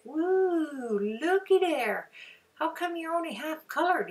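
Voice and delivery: high, chirpy birdie voice; a little pet bird piping up in surprise. Acted. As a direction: voice the bird, pitched high and squeaky. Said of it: "he's saying".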